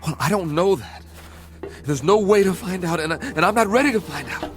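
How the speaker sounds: high-pitched, agitated protest; rising panic. Acted. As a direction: let panic push the pitch up. Panicking about the thing outside.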